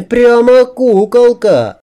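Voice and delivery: very high, loud, drawn-out squeal; gushing woman. A narrator's reading. She teases her dressed-up friend, high gushing squeal. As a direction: squeal it slowly in delight.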